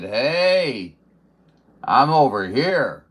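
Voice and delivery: calling out. Retold or imitated. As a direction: call out to the crowd. Retold calling out to searchers.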